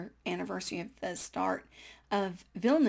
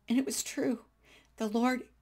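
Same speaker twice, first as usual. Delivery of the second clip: ordinary talk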